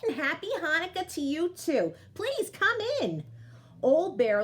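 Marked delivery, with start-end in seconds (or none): 0.0-3.2 put-on high, slowed old-lady voice; old Baba Brena. Baba Brena inviting the bear inside, high old-lady voice. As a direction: sweetly welcome the guest in. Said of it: "Baba Brena replies to the bear".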